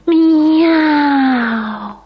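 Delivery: long high yowling meow; loud cat yowl. A narrator's reading. Cat voiced.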